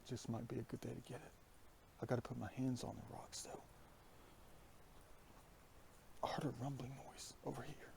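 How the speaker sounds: softened, half-whispered talk; hushed. Real, not acted. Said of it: "Why am I whispering?".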